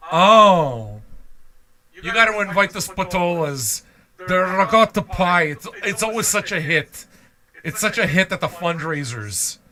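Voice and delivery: high, gushing New Jersey hostess; New Jersey Italian. Acted. Urging someone to invite the Spatolas, mock New Jersey Italian. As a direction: mimic a New Jersey Italian raving about a guest. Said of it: "you New Jersey ones".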